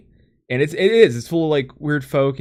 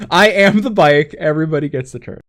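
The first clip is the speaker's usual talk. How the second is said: mock proclamation; 3 semitones higher, 6 dB louder than usual. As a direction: proclaim it like a soundboard clip, starting high. Replays joke line for soundboard.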